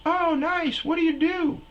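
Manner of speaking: put-on very high, loud falsetto girl; high girly voice. Comic voicing the concert girl, very high falsetto. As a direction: shriek it like a bubbly girl.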